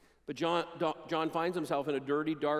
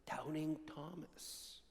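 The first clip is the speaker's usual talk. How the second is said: a hushed aside naming the doubter; whispered label; 3 semitones lower, 15 dB quieter, much slower than usual. Acted. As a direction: whisper the name very softly, slowly. Preacher names Thomas's label.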